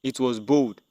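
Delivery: plain reading voice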